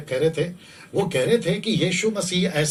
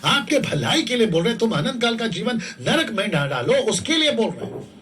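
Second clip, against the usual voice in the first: emphatic, raised preaching voice; urgent, pleading sermon delivery; full-force preaching; 4 dB louder than usual. Real, not acted. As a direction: proclaim it to the crowd, phrase by phrase.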